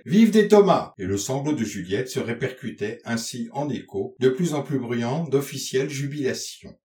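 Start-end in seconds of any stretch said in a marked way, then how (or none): 0.0-0.9 loud, high call back; answering distant cheer. A narrator's reading. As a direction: shout the cheer back as if from afar. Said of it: "Un machiniste, dans le lointain, répondait". (acclamation criée en réponse, de loin)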